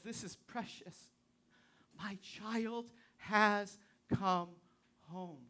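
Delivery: very slow, soft and hushed; God tender. Acted. God welcoming a saint who died, soft and very slow. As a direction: cradle each word like a father welcoming a child home. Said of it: "God looks at this and says".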